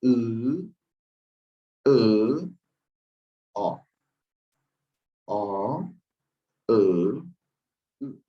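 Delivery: Ngoberé vowels with diaeresis, each held and sounded apart; vowel pronunciation demo. Shown as a demonstration. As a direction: demonstrate the vowels slowly and clearly. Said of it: "vamos a pronunciar nuevamente".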